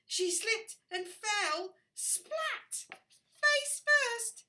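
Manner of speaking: high, loud, building excitement; dramatic storytelling. A narrator's reading. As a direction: tell the fall big and dramatic.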